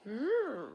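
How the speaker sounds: drawn-out high loud mm; a knowing, suggestive hum; a dramatic high hum before the twist; loaded hum. Retold or imitated. Reacting to Mia's act.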